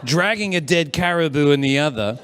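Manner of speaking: ordinary delivery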